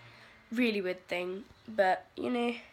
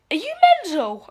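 higher, louder incredulous retort; 8 semitones higher, 8 dB louder, faster than usual. Retold or imitated. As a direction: fire it back in disbelief at mum. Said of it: "I was like".

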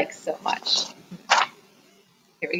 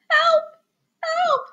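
tiny, very high cry for help; squeaky little voice calling out; high-pitched little plea; faint cry; 4 dB louder than usual. A narrator's reading. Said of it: "Scuttle heard a faint cry".

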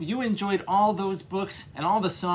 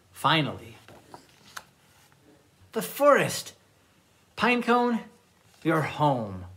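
put-on lively read-aloud for children; storytime reading. A narrator's reading. Librarian reads picture books aloud.

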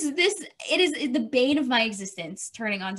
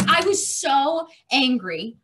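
usual speaking voice

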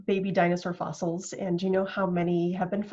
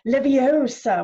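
Leviosa said levi-OH-sa, OH loud and drawn out; exaggerated syllable stress; 5 semitones higher, 9 dB louder, slower than usual. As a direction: lean hard on the O in Leviosa, Harry Potter style. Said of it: "Harry Potter thing going on here".

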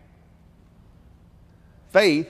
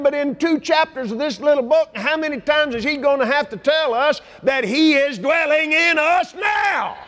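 preaching at full force; 6 semitones higher than usual. Real, not acted. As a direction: drive it home to the congregation. Preaching crescendo.

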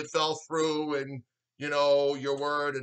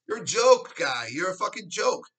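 ordinary talk, nothing marked

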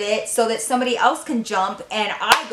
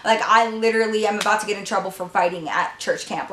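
ordinary delivery

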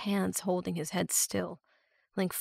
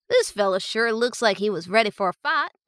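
put-on rural accent; 2 semitones higher, 7 dB louder than usual. A narrator's reading. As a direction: talk like a country ranch hand. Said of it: "The strong rural accent".

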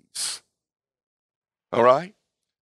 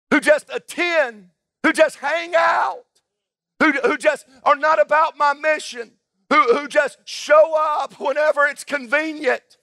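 high, impassioned pulpit cry with drawn-out key words; full-force preaching; 9 semitones higher, 4 dB louder, slower than usual. Acted. Preacher voicing the king's judgment on do-nothings, full-force preaching. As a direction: preach it up high, hammering each phrase.

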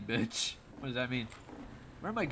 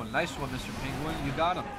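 ordinary talk

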